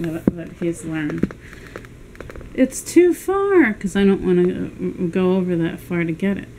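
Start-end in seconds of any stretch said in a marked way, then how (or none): 2.5-3.7 high slow coaxing. Real, not acted. Telling dog it's too far.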